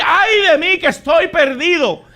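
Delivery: loud, high quoted cry; quoted lament. Retold or imitated. Preacher quoting the sinner's cry loudly to the church. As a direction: throw the lament out in passing. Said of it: "no tenemos que decir".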